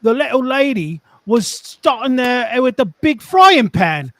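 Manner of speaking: put-on Cockney storytelling; Cockney accent. Reading a tradesman's story on request, Cockney accent. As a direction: read it like a London Cockney. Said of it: "and a Cockney accent".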